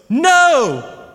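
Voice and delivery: high, drawn-out shout; imitated yell. One son echoing dad, imitated high yell. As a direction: copy a kid yelling it gleefully. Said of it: "And then I yell out".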